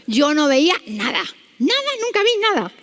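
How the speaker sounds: loud start, leaping to high-pitched denial; emphatic insistence. Real, not acted. Doctor tells audience she saw nothing, emphatic insistence.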